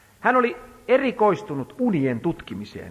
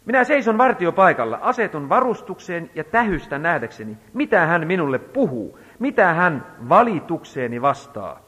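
raised, slowing solemn reading of scripture; 2 semitones higher, 5 dB louder than usual. Acted. Preacher reading Habakkuk to the congregation, solemn proclamation. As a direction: proclaim the prophet's words, slowing at the end. Reads scripture aloud in sermon.

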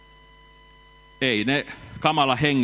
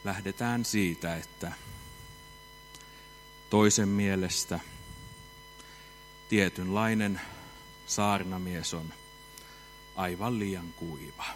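dry flat preacher; 6 semitones lower, 8 dB quieter than usual. Shown as a demonstration. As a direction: mimic a dull, monotone preacher quietly. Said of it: "puhuu tasaisella äänellä ja ääntä korottamatta". (hiljainen, tasainen ja kuiva saarnaajan ääni)

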